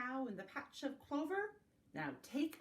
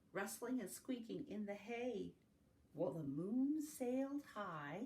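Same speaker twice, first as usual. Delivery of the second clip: plain reading voice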